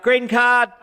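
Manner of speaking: loud high shout from the back; yelled out. Retold or imitated. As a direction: call it out like an excited fan. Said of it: "he actually yelled out".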